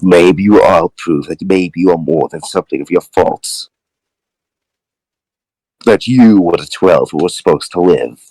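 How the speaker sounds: low-voiced, disapproving father; father's video message. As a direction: deliver a father's scornful last words, low.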